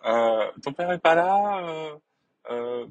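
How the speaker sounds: retry the mother, drawn out and high; man doing a woman's voice. Retold or imitated. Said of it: "Je m'y mets très mal, ma mère".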